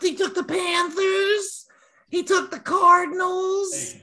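very high voice as the cat. Retold or imitated. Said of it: "your cat impersonation voice".

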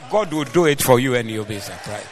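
ordinary talk

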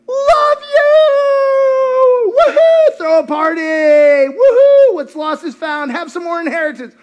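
very high, loud whooping joy; the father celebrating his returned son, wildly joyful; ecstatic father. Retold or imitated. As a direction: cheer like a father throwing a party. Father overjoyed at son's return.

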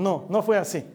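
ordinary delivery

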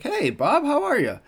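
very high, hearty hello; cheery greeting. Retold or imitated. Former employee greeting old boss in the store, cheery. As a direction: call back an over-friendly high hello.

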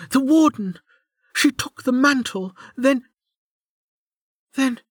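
high, halting, breaking off; dawning panic. A narrator's reading. Injured woman remembering the warden took the mantle, dawning panic. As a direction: piece it together in high, faltering bursts.

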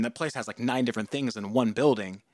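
ordinary talk

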